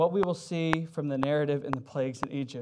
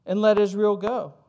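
louder, higher defiant king; defiant Pharaoh; 4 semitones higher, 6 dB louder than usual. Acted. Pharaoh defies God, scornful and loud. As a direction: throw the challenge back at God. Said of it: "Pharaoh picks a fight with God".

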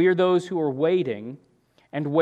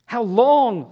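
frustrated cry; 9 semitones higher, 8 dB louder than usual. Retold or imitated. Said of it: "you just get frustrated".

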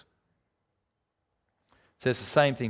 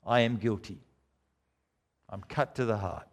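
much softer and lower, owning the guilt; quiet confession; 5 semitones lower, 7 dB quieter than usual. Acted. Preacher confessing his own guilt, soft and low. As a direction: let it sink in, hushed and sincere.

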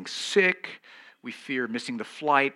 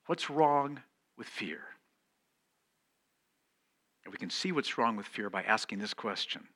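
ordinary delivery

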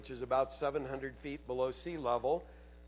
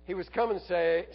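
usual speaking voice

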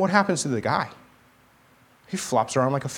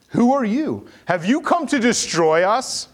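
higher, louder taunt, drawn out slowly; challenging demon; 9 semitones higher, 4 dB louder, slower than usual. Retold or imitated. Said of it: "the demon's challenging his authority".

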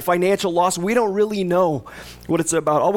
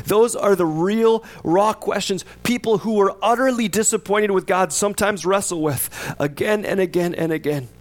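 ordinary talk, nothing marked

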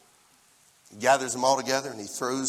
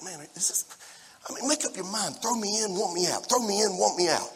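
higher, softer exasperated grumble; mock exasperation; 4 semitones higher, 4 dB quieter than usual. Meshach grumbling when he gets the call, mock exasperated. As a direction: complain in a high, put-upon voice.

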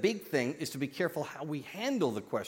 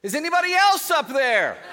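high, loud cry up the cliff; the man calls for anyone other than God, shouting; desperate shout; 12 semitones higher, 10 dB louder than usual. Acted. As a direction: holler the punchline, desperate. Punchline, man calls out again.

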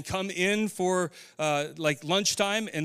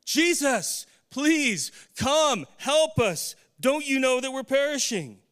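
much higher, louder desperate cry for help; panicked scream; 9 semitones higher, 4 dB louder, slower than usual. Retold or imitated. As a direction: cry out as terrified disciples in a sinking boat. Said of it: "It says that they were screaming".

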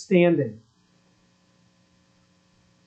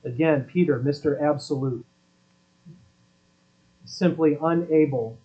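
ordinary talk, nothing marked

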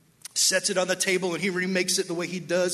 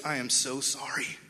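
much lower and softer, crestfallen; ashamed apology; 6 semitones lower, 7 dB quieter than usual. Retold or imitated. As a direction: confess it low and soft, face fallen. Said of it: "with this look on her face".

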